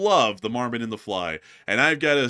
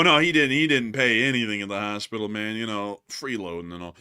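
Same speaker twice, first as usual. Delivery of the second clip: usual speaking voice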